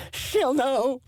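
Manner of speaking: mocking, high-pitched little protest cry; little-voiced yell. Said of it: "he yells it out like a little voice".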